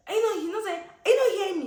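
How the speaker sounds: high, loud incredulous outburst; incredulous yell. Retold or imitated. Shouting back at the driver in disbelief, loud and high. Retold angry exchange in car.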